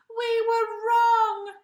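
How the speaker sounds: very loud, high, slow shout; calling out. Acted. The animals shouting their apology to the hippo. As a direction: call it out from far behind. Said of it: "a voice from behind him called out".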